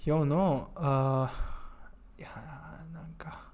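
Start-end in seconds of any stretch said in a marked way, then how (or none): 2.2-3.5 whisper. Real, not acted. Whispered aside.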